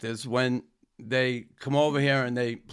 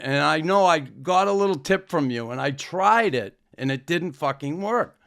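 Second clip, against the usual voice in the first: ordinary delivery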